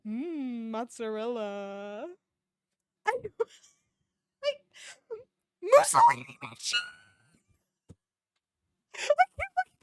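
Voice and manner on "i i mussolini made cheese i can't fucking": high, laughing, near tears; helpless laughter. Real, not acted. Reader loses it laughing at the Mussolini cheese line. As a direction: crack up until words break.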